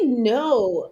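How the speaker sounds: ordinary talk, nothing marked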